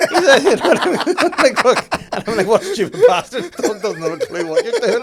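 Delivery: high, loud, laughing through a silly put-on voice; laughing mock voice. To a friend, retelling greeting a Spanish dog, in a high laughing voice. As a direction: crack up while voicing the dog's thoughts.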